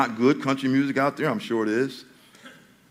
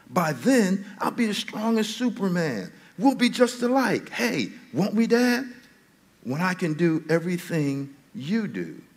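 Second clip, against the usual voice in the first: raised, lively recitation of a country song; reading song lyrics; 5 semitones higher than usual. Acted. Pastor reciting lyrics to the church, raised and lively. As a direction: read the lyric with feeling to the congregation.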